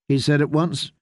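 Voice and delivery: ordinary delivery, nothing marked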